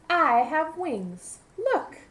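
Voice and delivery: high, slow showing-off bee; proud bumblebee. Acted. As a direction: show off your wings, pleased with yourself. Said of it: "said Bumblebee".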